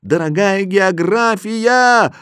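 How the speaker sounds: high, slow, drawn-out shout; long pleading yell; crying for help. A narrator's reading. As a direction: call out desperately, stretching each word. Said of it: "и я крикнул".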